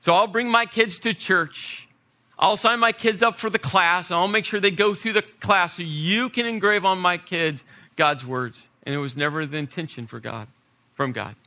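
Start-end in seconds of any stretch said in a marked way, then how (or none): 0.0-8.7 higher, louder mock-naive parent handing off the job; a hammed-up parent dumping the job on the experts. Parent telling the pastor to teach the kids, mocking and raised. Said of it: "and say".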